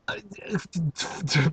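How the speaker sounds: breathy whispered giggle; whispered laugh. Real, not acted. Reader laughing quietly to herself, whispered.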